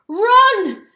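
high, loud scream. Acted. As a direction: scream the word at the weasel. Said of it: "screamed the dog".

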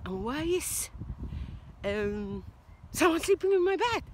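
put-on high, loud alarmed baby bear; startled baby bear. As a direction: squeal like the littlest bear. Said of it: "goes Baby Bear".

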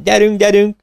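high, loud director's yell to hurry the stage; shouted call. A narrator's reading. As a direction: bark the order across the theatre. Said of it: "kiáltása".

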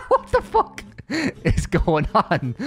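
slow, laughing what the fuck, pitched high; laughing disbelief. Real, not acted. Vlogger cracking up at another ginger on screen, laughing.

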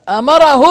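أمره drawn out, rising high and loud; emphatic stress. Retold or imitated. Lecturer quoting his opponents' claim, heavy stress on أمره. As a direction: punch أمره with a loud, lifted, stretched delivery.

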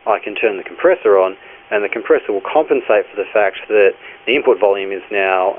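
normal talking voice

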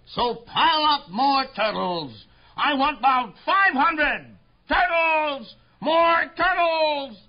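hammed-up shouted command, stretching the last words; bellowing and braying. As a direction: bray out orders to the whole pond. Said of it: "He bellowed and brayed".

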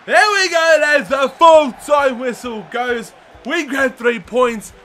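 loud, drawn-out full-time cry; elated and projected; triumphant announcement. Real, not acted. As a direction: declare the win with relish. Final whistle, game won.

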